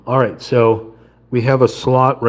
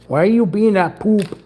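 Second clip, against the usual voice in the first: squeaky mock scold; 11 semitones higher than usual. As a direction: pretend to tell off a naughty toy. Scolding the stuck piece.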